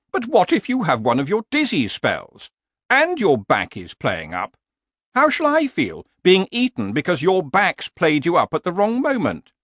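high-pitched horse, worrying aloud; nagging horse. Acted. Horse objects to the man's plan, high and nagging. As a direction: voice a fretful horse, pitched well up.

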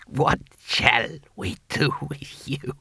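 put-on slow, whispery old ruler; halting, wheezy whisper; ancient emperor. Emperor musing over the intruder, slow whispery voice.

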